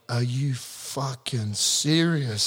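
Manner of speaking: very slow, stretched-out exasperation; drawn-out disbelief. Retold or imitated. To the teammate pulling out again, slow exasperated disbelief. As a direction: stretch every word in fed-up disbelief. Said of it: "I'm like".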